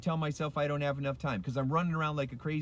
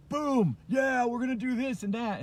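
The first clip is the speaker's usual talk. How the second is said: loud, high burst of hype; fired-up coach; 9 semitones higher, 3 dB louder than usual. Retold or imitated. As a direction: imitate a motivated, in-your-face coach. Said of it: "This guy was fired up".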